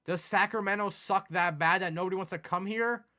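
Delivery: ordinary talk, nothing marked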